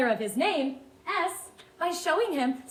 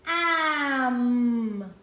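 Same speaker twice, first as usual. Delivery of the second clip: "Am" stretched into long aaammm; slow sounding-out of "Am"; slowly stretched out. Shown as a demonstration. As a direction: hold each sound, blending without a break. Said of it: "read this word slowly".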